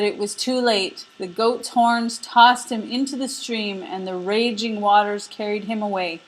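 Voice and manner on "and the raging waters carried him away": plain reading voice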